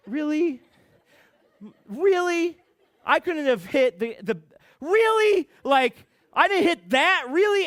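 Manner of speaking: incredulous. Retold or imitated. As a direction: protest in disbelief, pitch jumping on each "really". Retold disbelief after crashing.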